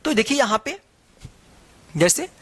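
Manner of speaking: ordinary delivery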